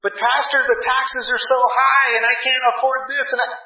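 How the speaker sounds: hammed-up worried church member; mock fretful churchgoer. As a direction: lampoon the anxious complainer. Mocking a fretful churchgoer's complaint.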